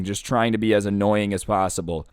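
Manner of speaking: ordinary talk, nothing marked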